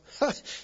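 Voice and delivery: short breathy disbelieving laugh; a quick laugh under his breath, like the old man hearing the promise. A narrator's reading. Abraham laughing to himself at God's promise, short laugh. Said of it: "fell on his face and laughed".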